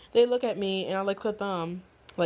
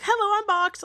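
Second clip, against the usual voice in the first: mock cheery greeting; 12 semitones higher, 5 dB louder than usual. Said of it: "this part creeped me the fuck out".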